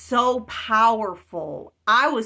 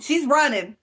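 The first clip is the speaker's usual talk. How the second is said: ordinary talk, nothing marked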